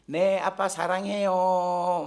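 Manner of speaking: put-on drawn-out, singsong child's reply; imitated daughter. Daughter answers her father's question, imitated in a slow singsong. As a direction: mimic a little girl's long, dutiful answer. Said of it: "우리 딸이 하는 말이".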